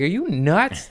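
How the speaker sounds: high, incredulous scolding; exasperated, pitched well up; retold angry yell. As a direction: re-enact asking in disbelief. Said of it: "I yelled at him".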